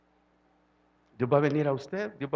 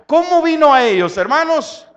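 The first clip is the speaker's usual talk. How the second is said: loud, driving sermon voice, much louder; full-force preaching; 7 semitones higher, 10 dB louder than usual. Acted. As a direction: preach it out to the congregation.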